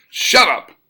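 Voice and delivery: furious shout, high and loud; barked. A narrator's reading. Hans yelling at Valterian to stop his questions, furious. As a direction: bark it at him in frustration. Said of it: "Hans barked".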